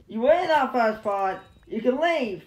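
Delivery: indignant dismissal. Pretend. Player sends away an old nuisance, indignant shout. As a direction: shout the intruder off.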